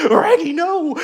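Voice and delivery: high, very loud cartoon dog; loud doggy voice with R-speech; Scooby-Doo. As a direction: yelp it like a frightened cartoon dog. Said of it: "Can you do the Scooby-Doo voice".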